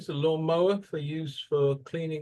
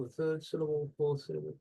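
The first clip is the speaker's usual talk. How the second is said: usual speaking voice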